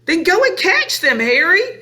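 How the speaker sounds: very high, insistent order; urgent hissing. A narrator's reading. Mother ordering father to face burglars, urgent hissing. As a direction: panicky wife pushing hard. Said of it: "hissed the mother".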